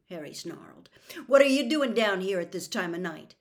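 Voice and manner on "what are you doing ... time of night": loud angry demand; angry confronting shout. Acted. Angry boy confronts friend.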